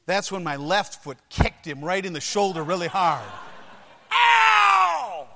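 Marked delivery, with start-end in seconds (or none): 4.1-5.3 loud, high, drawn-out howl; mock yelp of pain. Boy whose sore foot gets squeezed, hammed-up howl. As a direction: howl as if someone squeezed the injury.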